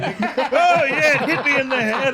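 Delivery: high, laughing fake innocence; mock innocent excuse. Coworker mocking an HR excuse, laughing. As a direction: play dumb through a laugh.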